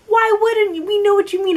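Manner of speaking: high, loud exasperated outburst. Real, not acted. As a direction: throw the question out in disbelief. Mock exasperated repeat of quote.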